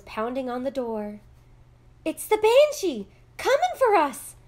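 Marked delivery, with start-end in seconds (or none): 2.0-4.4 high, loud shriek of alarm; terrified scream. A narrator's reading. Girl waking from deep sleep to pounding, screaming. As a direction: scream as if jolted awake in terror. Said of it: "Ailish screamed, waking from a deep sleep".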